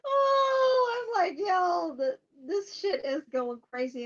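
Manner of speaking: high, loud cry of glee; excited laughing outburst. Real, not acted. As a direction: blurt it out giddy.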